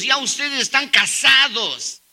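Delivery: normal talking voice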